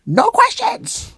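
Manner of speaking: high, drawn-out mock yell; mock stern refusal. Teacher refusing a private student's questions, hammed-up stern. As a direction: put on an exaggerated forbidding master voice.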